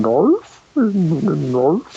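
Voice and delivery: a lightly voiced dumb Hot Fuzz villager; Hot Fuzz guy impression. Co-host imitating the Hot Fuzz guy's question, lightly voiced. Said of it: "that guy from Hot Fuzz that kept going".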